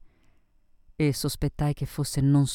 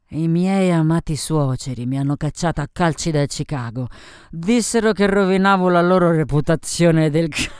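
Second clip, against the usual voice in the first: loud, scornful boozy rant; mock the in-laws with tipsy bravado; brash drunken woman, building louder; drunken, bitter Sophie; 3 semitones higher, 6 dB louder than usual. Drunk Sophie tells of her exile.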